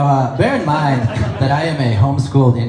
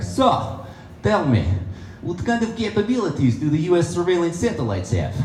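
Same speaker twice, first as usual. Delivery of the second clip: higher-pitched Russian astronaut; Russian accent; 7 semitones higher, 3 dB quieter than usual. Acted. Russian astronaut quizzing a rival on space station, Russian accent. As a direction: probe slyly in a Russian accent. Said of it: "the Russian guy would be like".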